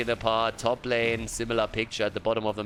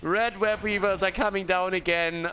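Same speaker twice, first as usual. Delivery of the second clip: high, laughing yelp of joy; real delighted caster cheer; excited cheer; 11 semitones higher, slower than usual. Real, not acted. Team survives clutch moment.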